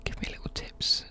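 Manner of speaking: ordinary delivery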